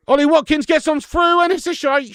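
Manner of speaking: high, loud call of the break; live call as the striker breaks through; excited commentary. Real, not acted. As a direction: shout the run, then groan at the miss. England chance then a miss.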